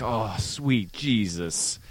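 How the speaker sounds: slow, soft, weary dismay; exasperated sigh. Retold or imitated. Son reacting to dad's yelling, slow exasperated groan. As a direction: let it sink out slowly, defeated.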